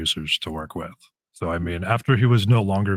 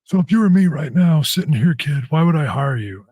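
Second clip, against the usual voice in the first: much higher, louder put-on boss voice; Johnny Ace impression; 8 semitones higher, 7 dB louder than usual. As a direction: play the gruff boss grilling a kid, pitched way up and loud. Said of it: "That impersonation was pretty good".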